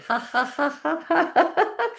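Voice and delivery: rising forced laugh; exercise laughter. Shown as a demonstration. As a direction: laugh deliberately while scrubbing away. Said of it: "Clean it with laughing".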